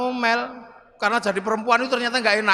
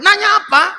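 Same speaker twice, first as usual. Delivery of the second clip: loud, higher gruff demand; fierce Umar; 8 semitones higher, 10 dB louder than usual. Acted. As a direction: snap at the angels impatiently. Umar answering the grave angels.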